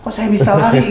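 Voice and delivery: mildly astonished, pitch lifted; retold surprise. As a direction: act out startled disbelief at yourself. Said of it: "saya kaget".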